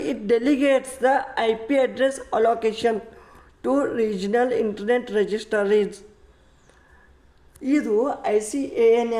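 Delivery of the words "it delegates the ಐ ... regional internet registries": very slow, word by word definition; slow textbook reading. Shown as a demonstration. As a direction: dictate the definition for notes. Teacher reads definition aloud.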